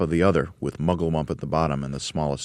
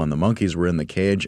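plain reading voice

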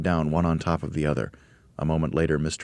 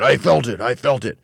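raised, loud, agitated repetition; shouting; 7 semitones higher, 6 dB louder than usual. A narrator's reading. Mr Twit tells his wife he felt it, shouting. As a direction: blurt it out at a shout. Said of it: "shouted Mister Twit".

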